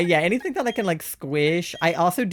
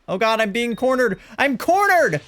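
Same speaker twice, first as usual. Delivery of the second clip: mock panic; 8 semitones higher, 6 dB louder than usual. Real, not acted. Panicking in the game.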